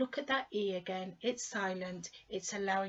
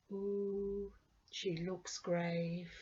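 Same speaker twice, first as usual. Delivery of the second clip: soft, slow ooh of concern; hushed concern; 3 semitones lower, 5 dB quieter, much slower than usual. Retold or imitated. Someone quietly remarking on a stern-looking woman, with soft concern. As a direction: murmur it as if worried about her. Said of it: "We say".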